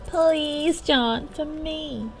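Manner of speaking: high, drawn-out begging; wheedling plea. Acted. Girl begging John for a favour, wheedling. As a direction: stretch it out slowly with puppy-eyed coaxing. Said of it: "my best attempt at puppy eyes".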